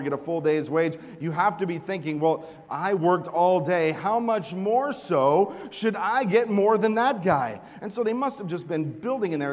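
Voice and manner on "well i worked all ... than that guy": high, loud, slow indignation; an aggrieved laborer sure he's owed more; indignant worker. Pretend. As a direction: plead your case, loud and wounded. Workers imagining their fair due.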